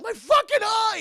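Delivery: high, very loud outraged scream; pained yell. Acted. Mercenary cries out over his injured eye, pained yell. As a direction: yell it like you're hurt.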